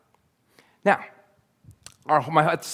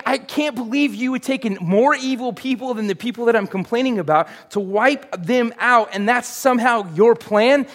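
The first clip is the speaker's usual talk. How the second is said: indignant Habakkuk; 8 semitones higher, 5 dB louder than usual. Acted. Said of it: "he's like".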